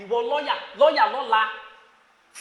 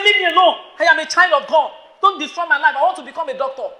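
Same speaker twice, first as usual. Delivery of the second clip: loud, high protest; rebuking a boy; 4 semitones higher, 7 dB louder than usual. Pretend. Girl in school shouting at a boy touching her. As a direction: shout him off, then calm toward the end.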